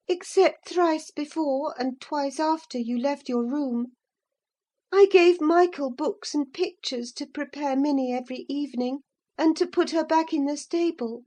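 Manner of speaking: put-on high girlish voice, earnest and eager; a girl owning up to her secret; young Catherine confessing. A narrator's reading.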